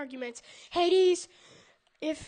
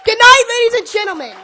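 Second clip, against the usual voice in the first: shouted sign-off; 6 semitones higher, 17 dB louder than usual. Real, not acted. Sign-off to the crowd.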